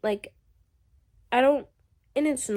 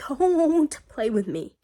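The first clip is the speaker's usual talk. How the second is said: higher, slow, drawn-out warning; slow, emphatic indignation; angry warning; 6 semitones higher, 5 dB louder, slower than usual. Real, not acted. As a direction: stretch out "don't" as a threat. Warning the absent friend.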